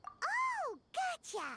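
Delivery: high, bright little companion voice; high Paimon voice. Acted. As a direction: pitch way up as Paimon. Voicing Paimon's lines.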